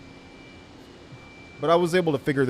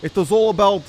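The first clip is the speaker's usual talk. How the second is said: hammed-up Russian accent, pitched higher; mock Russian accent; 4 semitones higher than usual. YouTube trader opening his video to viewers in a put-on Russian accent. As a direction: lay on a thick joke Russian accent to tease nervous traders. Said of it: "I can't do this accent the whole call".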